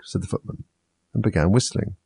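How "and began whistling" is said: plain reading voice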